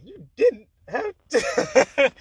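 high, very slow, whining plea; exasperated. Retold or imitated. Echoing his friend about her move: high, drawn-out, whining plea. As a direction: drag it out, pleading.

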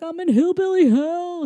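hammed-up indignant wail; lightly voiced furious race car; mad. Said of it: "He was so mad".

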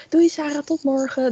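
high, bright put-on sweetness; a hint of fake friendliness calling after Sarah; mock fake-sweet goodbye. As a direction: imitate classmates being over-nice. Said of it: "zo aardig dat ik dacht van".

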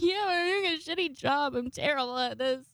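high, teary retold whine, a hint of crying; mock weepy whine. Retelling crying to the head chef, high mock-weepy whine. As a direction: whimper it like you're about to cry again. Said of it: "And I start fucking crying".

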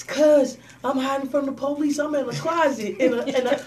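high-pitched panicked imitation; high frantic cousin voice. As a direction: play the scared cousin, voice up high. Cousin hiding from police.

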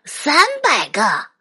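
slow astonished exclamation; surprised clown. Acted. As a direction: gasp out the number. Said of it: "小丑叫起来".

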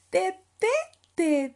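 high, loud ticklish protest; giggly little cub begging to stop; squealed, broken by giggles; through laughter. Acted. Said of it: "entre risas".

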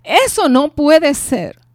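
grito agudo y fuerte, desesperado; grito de incredulidad. Retold or imitated. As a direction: gritar que no puede ser, roto. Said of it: "un grito que él dice". (grito fuerte de incredulidad)

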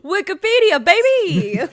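loud, high, crowing boast; triumphant exclamation. Real, not acted. Speaker proudly naming the research source, triumphant exclamation. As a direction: belt it out proudly.

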